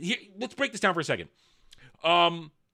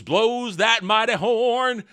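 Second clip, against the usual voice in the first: mock-epic; 4 semitones higher, 4 dB louder, slower than usual. Hamming up the horn blowing.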